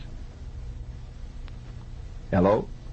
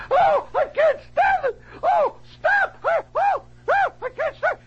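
very high, hammed-up wailing yell; panicked yelling. Fleeing dental patient runs out yelling, high and frantic. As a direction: imitate a patient shrieking in total panic. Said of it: "And he's yelling".

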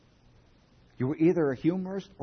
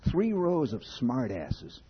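ordinary talk, nothing marked

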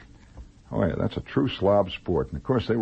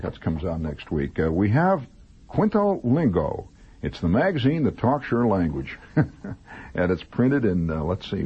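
ordinary delivery

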